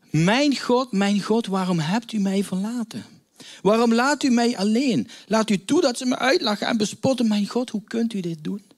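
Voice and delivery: anguished cry. Acted. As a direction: cry out abandoned and broken. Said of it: "En hij schreeuwt".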